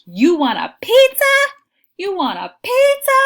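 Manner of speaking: loud, high exclamation peaking on PIZZA. Shown as a demonstration. Teacher shows students an exclamation mark, loud excited exclaiming. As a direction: exclaim it at full volume, voice leaping up. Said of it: "tells them we need an exclamation mark".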